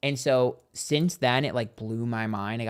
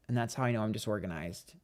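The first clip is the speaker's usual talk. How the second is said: ordinary talk, nothing marked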